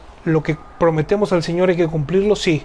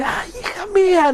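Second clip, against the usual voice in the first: much higher wail, breaking into a laugh; anguished cry; 13 semitones higher than usual. Shown as a demonstration. Father's scream re-enacted for listeners, high wailing cry. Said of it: "Así de".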